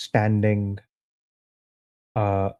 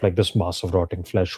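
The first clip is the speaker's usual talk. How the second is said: usual speaking voice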